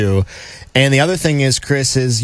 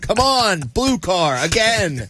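excited coach; 7 semitones higher, slower than usual. Acted. Coach greedy for more rides.

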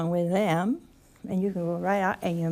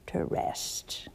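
whispered; 7 dB quieter than usual. Real, not acted. Gentle reflection on needing rest.

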